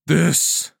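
loud, high, defiant shout; furious roar. A narrator's reading. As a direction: roar it mid-fight. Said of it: "still roaring in fury".

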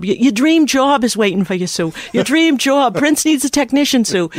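lightly voiced Boston guy; Boston accent. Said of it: "he had this really strong Boston accent".

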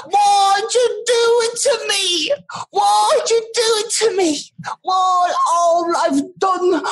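high, drawn-out mock wailing; slow, squealed tearful plea of a little boy; crying orphan boy. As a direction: wail pleadingly through hammed-up sobs. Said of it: "he's crying and he's looking at humanity".

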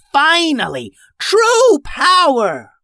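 high, loud, drawn-out villain yell; triumphant yell. Acted. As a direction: shout out your new power. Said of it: "Sweetie Bug yelled".

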